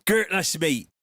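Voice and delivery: hammed-up Bristol greeting; thick Bristol accent. Shown as a demonstration. Streamer obliging a viewer's request, thick Bristol accent. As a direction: put on a broad Bristol accent. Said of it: "say Gertlush in your thickest Bristol accent".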